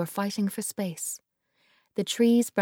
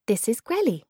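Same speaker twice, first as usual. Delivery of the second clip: put-on a touch of a higher child voice; child introducing; 5 semitones higher, 5 dB louder than usual. Girl introducing her grandma to her friend, lightly higher. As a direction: present grandma brightly.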